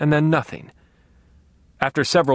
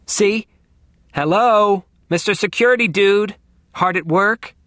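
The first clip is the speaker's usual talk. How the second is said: higher, louder sarcastic hello; mocking greeting; 7 semitones higher, 4 dB louder than usual. A narrator's reading. Nerd mocks the sleeping security guard on screen, sarcastic. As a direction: tease the lazy guard with mock cheer.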